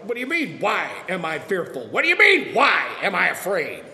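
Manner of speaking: building from fretful protest to a loud panicked shout; panicked disciples yelling. Retold or imitated. Disciples shouting to Jesus over the storm, building panic. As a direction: yell it like men in a sinking boat. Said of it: "you're like".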